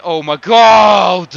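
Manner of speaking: drawn-out loud cry of disbelief; slow, loud shout of frustration; exasperated shout. Real, not acted. Exasperated at missed chance.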